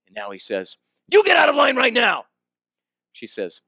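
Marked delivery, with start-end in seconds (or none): 1.1-2.3 high, loud, furious order; retold scream. Angry man ordering the woman out of line, shouting high and loud. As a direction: shout it as an enraged traveler. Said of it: "He screams at the back of her head".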